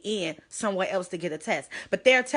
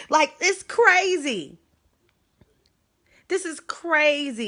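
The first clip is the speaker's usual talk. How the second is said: exasperated; 7 semitones higher, 5 dB louder, slower than usual. Real, not acted. Venting disbelief on her live.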